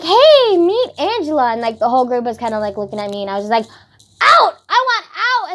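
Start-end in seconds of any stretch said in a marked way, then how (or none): 0.0-1.5 imitating an over-eager friend, high and loud; booming high mock introduction to a group. Acts friend loudly introducing her. 4.2-5.5 high, loud yelp to escape; hammed-up desperate outburst; mock panic. Acts her panicked inner reaction.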